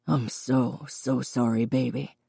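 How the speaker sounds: low, soft, heartfelt apology; remorseful father. Acted. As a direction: apologise tenderly, low and hushed. Father apologises to his daughter.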